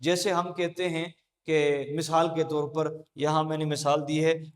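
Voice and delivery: ordinary delivery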